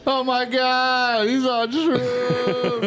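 high, slow, drawn-out "oh my God"; mock astonishment. Real, not acted. Finding out his friend is on shrooms, teasing. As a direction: stretch it out in teasing disbelief.

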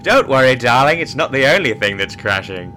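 loud, smug pilot; suave captain. Acted. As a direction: deliver the pun with swagger. Acted pilot sketch.

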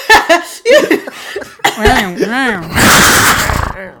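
loud helpless laughing fit; loud laughter. Real, not acted. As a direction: burst out laughing. Everyone cracks up at impression.

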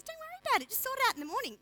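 light high brush-off; a breezy high don't-worry; high dismissive husband. Retold or imitated. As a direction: wave it away in a high voice.

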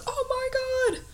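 high, breathy shocked exclamation; shocked gasp. Retold or imitated. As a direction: gasp it out in disbelief. Crowd gasps at crash.

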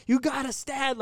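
high, dramatic plea, imitated; movie-style urging. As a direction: urge him on like a film hero. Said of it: "on some fucking movie shit".

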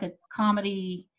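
normal talking voice

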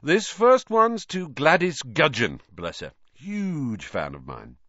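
high, slow, self-pleased delivery; Lockhart. A narrator's reading. Vain teacher handing pupil fan mail, self-pleased. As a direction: offer it like a treat, then drop for the aside. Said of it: "as though this was a huge treat".

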